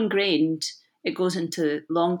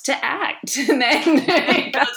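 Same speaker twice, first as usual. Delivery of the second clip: laughing high at herself; 7 semitones higher, 5 dB louder than usual. Real, not acted. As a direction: giggle through the self-mockery. Joking about her own acting.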